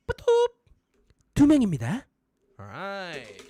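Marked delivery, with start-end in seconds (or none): 0.1-2.0 high, loud robotic announcement; machine voice. Retold or imitated. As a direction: sound like the fare machine. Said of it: "that's when you go".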